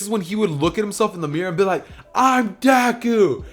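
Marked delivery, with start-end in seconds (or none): 2.1-3.4 high, loud, drawn-out fanboy cry; thrilled exclamation. Acted. Deadpool seeing himself as Deku in the mirror, thrilled shout. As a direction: cry it out high and slow, overjoyed. Fan sees himself as his idol.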